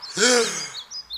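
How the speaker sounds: sharp intake of breath; revival gasp. Retold or imitated. Finding his father on the floor, he gasps for air. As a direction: gasp back to life. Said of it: "And I went".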